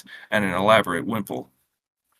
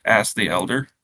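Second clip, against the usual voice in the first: usual speaking voice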